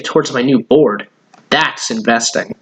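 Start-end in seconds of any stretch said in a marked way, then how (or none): none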